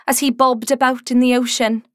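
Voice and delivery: ordinary talk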